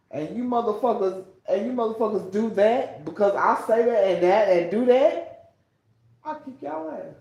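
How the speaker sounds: loud, heated ranting yell; full-volume furious rant; angry shout. Real, not acted. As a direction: shout it at them, fed up. Ranting at people who mocked her.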